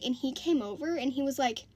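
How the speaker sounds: ordinary delivery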